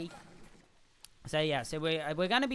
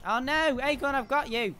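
loud, high cry to a teammate; urgent rescue shout; 9 semitones higher, 7 dB louder than usual. Real, not acted. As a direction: call out as you rush to help. Rushing to save a teammate.

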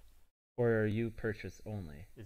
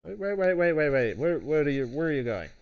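calling out; 4 semitones higher, 7 dB louder, faster than usual. Real, not acted. Calling after the departing wizard.

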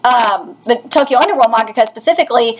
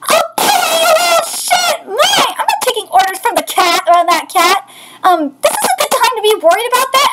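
raised, lively manga character voices; voicing manga characters; 7 semitones higher than usual. Reading the manga's scene aloud in lively raised character voices. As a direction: bounce between the characters' lines.

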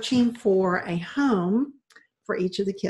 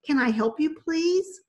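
firm; 8 semitones higher, 4 dB louder than usual. A narrator's reading. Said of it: "Mandy said firmly".

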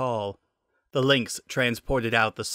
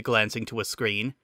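plain reading voice

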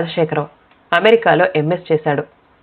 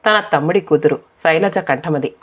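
ordinary delivery, nothing marked

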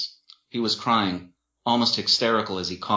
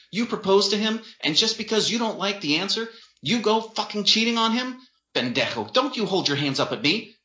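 high, outraged scolding; aggressive tirade; 12 semitones higher than usual. A narrator's reading. Sister berating her cheating brother, aggressive. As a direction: tear into him with mounting indignation. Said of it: "She spat in rapid-fire aggression".

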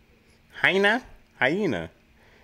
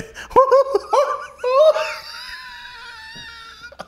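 hyena laugh. Real, not acted. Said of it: "[name] got one noise".

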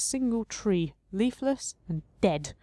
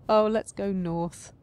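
ordinary delivery

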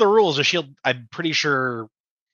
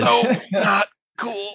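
villain line; 7 semitones higher, much slower than usual. Acted. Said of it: "And he just says".

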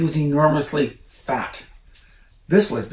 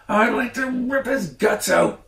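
high furious threat; angry cry; 11 semitones higher, 4 dB louder than usual. A narrator's reading. Farmer Bogus threatening the fox, high furious cry. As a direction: snarl it out in high rage. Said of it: "cried Mister Bogus".

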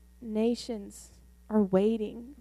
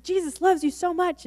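high and loud, beaming; excited; 8 semitones higher, 5 dB louder than usual. Retold or imitated. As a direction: pour delight on her. Repeats joy to healed girl.

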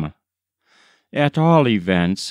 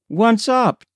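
calling out; 11 semitones higher than usual. A narrator's reading. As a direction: sing it out high to the driver. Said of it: "called Jimmy".

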